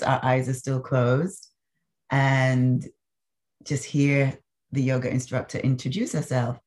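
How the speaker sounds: ordinary talk, nothing marked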